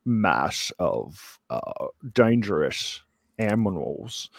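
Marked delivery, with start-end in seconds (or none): 3.4-4.3 "animals" garbled as "amminals" in fake Aussie; put-on Aussie mangling of "animals"; mock Australian pronunciation. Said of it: "That's how we say animals in Australia".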